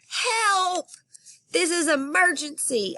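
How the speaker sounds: high, shrill-pitched cry for help; yelling for help. Acted. Girl yelling into the phone for help, very high. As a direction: scream it into the phone. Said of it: "I yelled".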